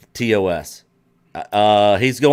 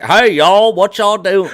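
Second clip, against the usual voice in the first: hammed-up Southern country accent, higher; mock country accent; 4 semitones higher, 4 dB louder, slower than usual. Mocking how a Southern friend is imagined to talk, country accent. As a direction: drawl a Southern country hey y'all. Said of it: "we're like these country".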